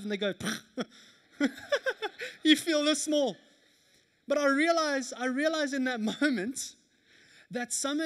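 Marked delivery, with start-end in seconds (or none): 1.4-2.4 mocking child laugh. Kid refusing a high five.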